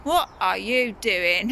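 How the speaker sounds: normal talking voice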